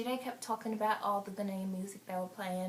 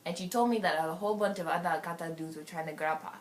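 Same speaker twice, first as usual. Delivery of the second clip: lightly put-on African accent; 12 dB louder than usual. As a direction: tell the party story in an African accent. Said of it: "I'm gonna go to African".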